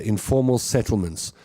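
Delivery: ordinary talk, nothing marked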